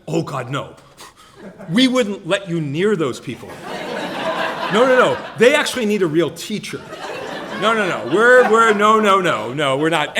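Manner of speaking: loud, high, laughing no-no-no; hammed-up panic at the idea; mock horrified refusal. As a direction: colleague Pam turning him down in loud, laughing alarm. Pam refusing, voiced by teller.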